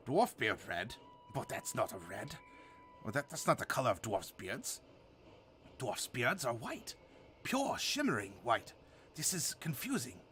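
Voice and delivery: soft, puzzled dwarf voice; quietly baffled old dwarf; confused dwarf voice. Acted. Confused albino dwarf objects.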